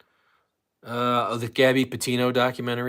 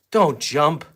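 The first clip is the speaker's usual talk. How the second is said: louder, insistent command to a dog; urgent, raised-voice caution; firm warning; 3 semitones higher, 7 dB louder than usual. Real, not acted. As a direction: warn the dog sharply and loudly. Repeated, firmer warning to the dog.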